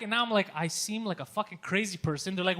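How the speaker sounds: ordinary talk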